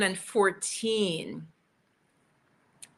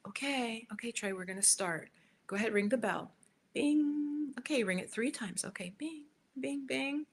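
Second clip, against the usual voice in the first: soft coaxing, bings sung higher; gentle coaxing to a child; 5 semitones higher, 4 dB quieter than usual. Shown as a demonstration. Parent guiding a three-year-old to ring the bell, gently. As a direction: coax a little one to ring the bell. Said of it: "You have the three-year-old ring the bell".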